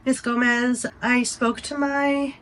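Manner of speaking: a hint of a sweet doctor's greeting; sweet attending. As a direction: greet her gently like a kind doctor. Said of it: "so sweet".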